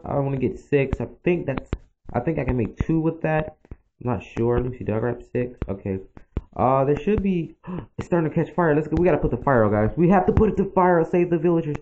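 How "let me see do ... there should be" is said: ordinary talk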